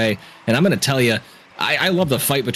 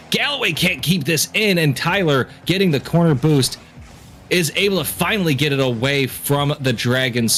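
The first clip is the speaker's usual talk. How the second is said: normal talking voice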